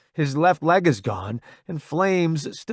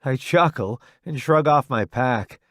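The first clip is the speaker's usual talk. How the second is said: plain reading voice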